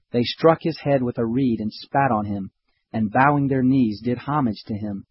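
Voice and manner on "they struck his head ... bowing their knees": plain reading voice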